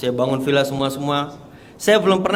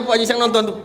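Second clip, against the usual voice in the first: ordinary talk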